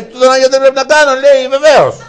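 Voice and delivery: high and loud, laughing through it; barely holding back laughter. Acted. Friend on phone, laughing. (γελώντας δυνατά)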